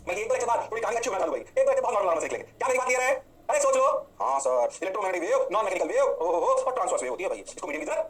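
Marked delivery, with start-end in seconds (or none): none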